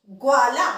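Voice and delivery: ग्वाला with ग and व fused, ग्वा in one syllable; careful pronunciation. Shown as a demonstration. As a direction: say ग्वाला clearly, loud, ग्व joined. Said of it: "उच्चारण ध्यान से सुनिएगा". (ग्वाला का साफ़ उच्चारण, ग्व को जोड़कर)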